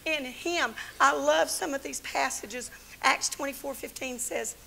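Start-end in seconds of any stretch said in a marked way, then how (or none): 0.0-0.8 loud, rousing sermon climax; full-force preaching. Acted. Preacher rousing daughters of the King, loud. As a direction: preach it out to the room.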